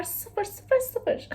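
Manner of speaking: rising high, trailing to a whisper; high, then breathy and soft; high fading count. Real, not acted. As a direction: let the zeros float up and die away.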